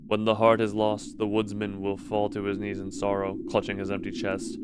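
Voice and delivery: low, even storyteller read; story narration. Acted. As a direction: read it like a dark fairy tale.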